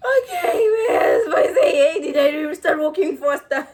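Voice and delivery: losing it completely while talking; laughing. Real, not acted. Laughing hard while retelling the bouncers spotting them. Helpless laughter mid-story.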